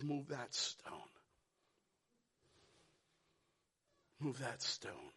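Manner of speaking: low, soft solemn command; hushed, solemn order at the tomb. Acted. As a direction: speak it quietly with authority.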